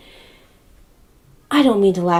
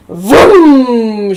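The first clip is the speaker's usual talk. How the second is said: loud, drawn-out vroom falling in pitch; car vroom imitation; 2 semitones higher, 10 dB louder than usual. Neighbor speeding off, loud imitated engine vroom. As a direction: imitate a car zooming away, loudly.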